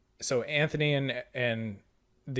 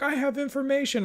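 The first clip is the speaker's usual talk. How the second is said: much higher, plaintive call; pleading messenger; 14 semitones higher than usual. Retold or imitated. Messenger calling after the departing player, high. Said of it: "he's like".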